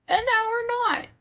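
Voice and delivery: high, breaking cry of despair; crying panic. Acted. Barry realizing they were never flying, crying out. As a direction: wail it as the plane fails. Plane loses autopilot, panic.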